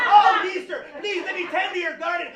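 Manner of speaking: cartoonish Mexican accent. Shown as a demonstration. Gardener begging a mister for work, cartoonish Mexican accent. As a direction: beg loudly in a hammed-up Mexican accent. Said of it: "real racist and cartoonish and stereotypical".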